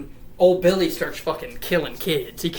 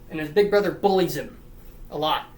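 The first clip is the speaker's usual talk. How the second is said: ordinary talk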